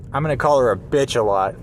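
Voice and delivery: ordinary delivery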